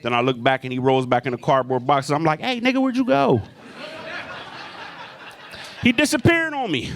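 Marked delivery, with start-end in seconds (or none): 2.4-6.9 high-pitched comic bewilderment; baffled. Acted. Comic calling after a vanished dealer, high baffled cry. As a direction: call out high, thrown by the disappearance. Man vanishes back into boxes.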